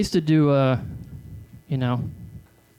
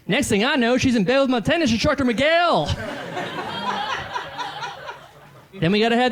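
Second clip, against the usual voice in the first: hack club comic; 10 semitones higher than usual. Acted. Comic in character working the crowd, brash high shouting voice.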